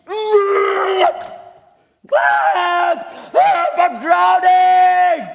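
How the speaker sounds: loud, slow hammed-up cries for help; mock drowning cry. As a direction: ham up a drowning man.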